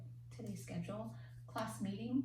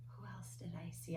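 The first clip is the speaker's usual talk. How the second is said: whispering; 9 dB quieter than usual. Real, not acted. Wondering aloud to herself.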